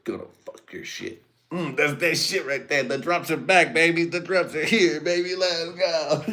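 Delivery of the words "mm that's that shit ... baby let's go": high, loud hype shout; hyped up. Real, not acted. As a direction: cheer it like the party's back. Hyped about the drops.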